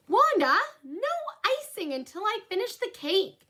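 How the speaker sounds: high, firm big-sister voice; big sister scolding. Acted. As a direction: tell your little sister off.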